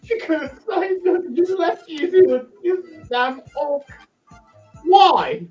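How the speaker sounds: high whiny lament; whining despair. Acted. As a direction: wail at being abandoned. Auric despairs as Luno leaves.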